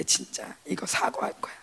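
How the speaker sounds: a soft whispered vow; whispered resolve. Retold or imitated. Whispering her plan to apologize to her husband.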